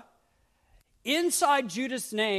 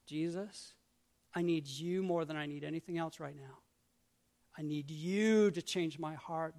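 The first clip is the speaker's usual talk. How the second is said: quiet prayer; 5 semitones lower, 8 dB quieter than usual. Shown as a demonstration. Modelled prayer.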